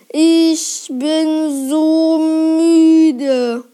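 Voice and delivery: extremely slow, drawn-out drowsy voice; sleepy trance murmur. A narrator's reading. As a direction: drag every word as if falling into a trance. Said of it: "murmelte er". (schläfrig gedehnt, wie in Trance)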